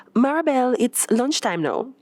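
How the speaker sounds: a hint of a cheerful coworker; Renee's voice.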